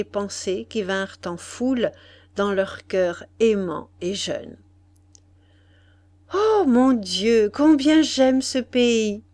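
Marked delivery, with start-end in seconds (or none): 6.3-9.2 slow, loud, rapturous exclamation; higher voice, savoring every word; naive enthusiasm. A narrator's reading. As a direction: gush with growing delight. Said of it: "avec un enthousiasme croissant et naïf".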